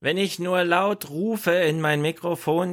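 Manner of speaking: mock slow call. Mocking the minister's bad audio.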